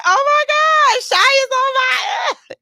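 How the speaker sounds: high, slow squealing shout; starstruck fangirl squeal. Retold or imitated. Host reenacts her starstruck shriek at seeing Shy. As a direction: replay the fangirl scream. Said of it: "I feel starstruck".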